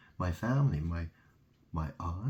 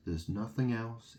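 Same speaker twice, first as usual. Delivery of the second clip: usual speaking voice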